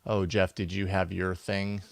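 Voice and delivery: usual speaking voice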